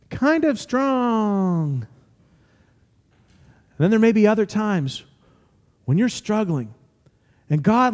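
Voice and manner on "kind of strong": slow, drawn-out "kind of strong", sagging away; half-hearted conviction. Shown as a demonstration. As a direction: let "strong" deflate slowly. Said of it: "other times when your faith is".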